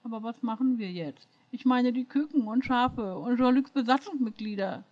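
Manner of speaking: a touch of teary mumbling into a sweater; mildly sniffly, mumbled worry; sniffling mumble. A narrator's reading. Jenny mumbles into Q's sweater, lightly sniffling. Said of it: "Schniefend ... brummelte in seinen Pullover".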